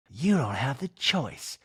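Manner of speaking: ordinary talk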